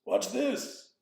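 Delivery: high, booming WHAT'S THIS; loud demand. Shown as a demonstration. Teacher showing what the koan needn't be, aggressive shout. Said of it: "It doesn't have to be".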